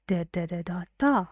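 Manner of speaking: even "da" syllables, last one stressed; rhythm demo. Shown as a demonstration. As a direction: tap out the sentence on "da", landing on the end. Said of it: "Say it with da".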